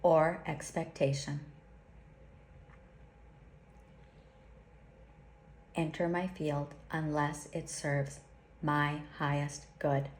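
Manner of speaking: slow, solemn prayer with long pauses; slow invocation. Acted. Teacher leads listeners through a protection invocation, slowly and solemnly. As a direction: intone each line like a sacred vow. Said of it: "I'll say it slowly".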